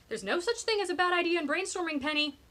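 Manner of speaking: high, loud indignant objection; loud protest. A narrator's reading. Defending bad ideas to Penny, loudly indignant. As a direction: call it out over the squabble. Protest during rapid brainstorming squabble.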